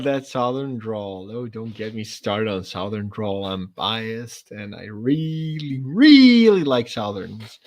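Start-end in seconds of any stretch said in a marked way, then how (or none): 4.9-7.6 REALLY stretched out long and slow; drawn-out gushing. Real, not acted. Confessing a love of Southern speech, drawn-out emphasis on "really". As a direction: drag out "really", swelling on the second one.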